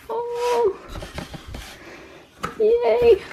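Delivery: high-pitched delighted squeal, far above her normal voice; excited squeal. Real, not acted. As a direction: squeal with joy at the package. Squeals over unboxed collection.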